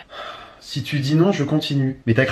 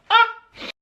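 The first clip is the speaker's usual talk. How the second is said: high panicked shriek. Acted. Cornered by the caller, a high yelp. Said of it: "Oh, je panique".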